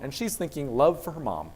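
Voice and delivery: ordinary delivery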